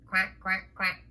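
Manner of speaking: low, soft quacking; short pretend quacks; duck quacks. Voicing the duck being put away.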